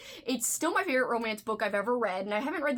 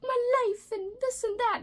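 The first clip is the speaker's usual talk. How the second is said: high, mocking whiny rich-girl voice; high pitched rich-girl parody; 13 semitones higher, 3 dB louder than usual. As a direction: sneer at the spoiled characters in a high whine. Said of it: "how it was just like".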